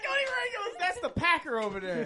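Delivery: high laughing shout, slow; laughing call-out. Real, not acted. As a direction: call across the room while laughing. Calling out laughing.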